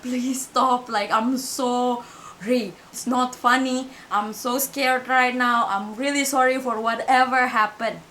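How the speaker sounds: slow, trembling plea of apology; scared apology. Retold or imitated. Frightened girl apologizing to the unseen voice, slow scared plea. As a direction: beg forgiveness slowly, frightened. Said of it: "kayak Minyo minta maaf".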